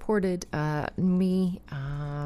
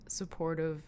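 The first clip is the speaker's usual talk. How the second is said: usual speaking voice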